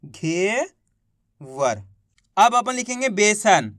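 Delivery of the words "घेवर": slow drawn-out word. Shown as a demonstration. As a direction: stretch घेवर out very slowly, lower. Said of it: "तो मिलके बन गया". (घेवर बहुत धीरे खींचकर)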